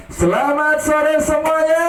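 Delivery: high, loud greeting to the crowd; loud greeting call. Real, not acted. Host greeting the children loudly and high. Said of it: "Ulangi, ya".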